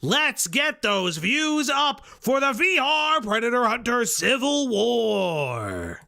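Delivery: big, slow announcer delivery stretching the last words; hype announcer. Pretend. Streamer plugging a civil war to viewers, hammed-up announcer. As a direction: draw it out like billing a main event.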